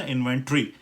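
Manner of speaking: इन्वेंटरी as in-ven-tree; British pronunciation. Shown as a demonstration. Teacher explains the squeezed ending, British pronunciation. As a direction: run t and r together in इन्वेंटरी. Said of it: "टी आर ई करके पढ़ लिया".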